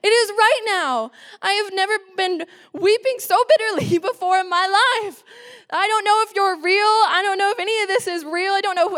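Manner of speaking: high, overwrought wail without real tears; retold bitter weeping. Distraught nineteen-year-old pleads with God, acted-out high wail. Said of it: "if I've ever wept bitterly".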